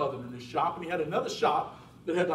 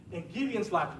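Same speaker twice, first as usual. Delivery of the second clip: usual speaking voice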